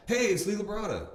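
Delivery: high, loud friendly intro; bright confident hello; cheery caller. Retold or imitated. Voicing Lee introducing himself.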